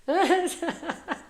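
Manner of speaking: high burst of laughter; helpless high giggle; amused laugh. Real, not acted. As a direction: crack up at yourself. Laughing at own accent slip.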